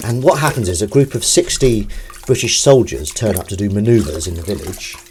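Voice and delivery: ordinary talk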